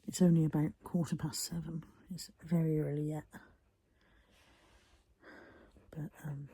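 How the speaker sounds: hushed whisper so as not to disturb the dog. Real, not acted. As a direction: breathe the words out softly, barely voiced. Whispering while the dog rests.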